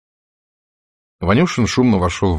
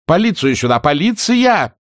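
high, loud calling out; shouting for help; 10 semitones higher, 5 dB louder than usual. Acted. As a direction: yell for the police. Delegates call for police.

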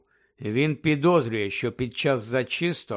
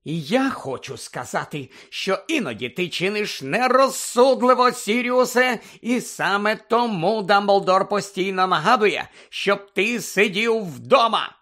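heated rebuke; 7 semitones higher, 5 dB louder than usual. Acted. Heated quarrel, she answers back.

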